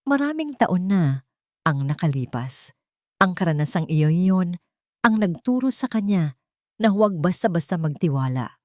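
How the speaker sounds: ordinary delivery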